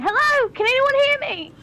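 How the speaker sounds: high, pleading call into the distance; calling for help. Acted. Minx hears footsteps in the darkness, calling out.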